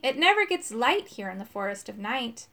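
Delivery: usual speaking voice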